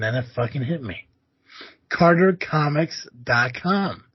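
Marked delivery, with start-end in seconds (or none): 1.9-4.0 slow, punchy ad-read reveal; ad-read plug. Acted. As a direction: sell the sponsor name slowly.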